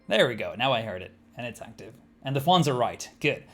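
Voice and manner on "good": ordinary delivery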